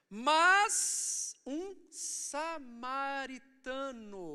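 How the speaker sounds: very slow, loud opening word, syllables stretched out; drawn-out dramatic quote. Acted. Preacher quoting Jesus to the church, slow and dramatic. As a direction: proclaim it word by word, hugely drawn out.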